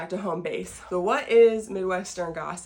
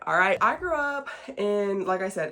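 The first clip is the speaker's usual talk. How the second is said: ordinary delivery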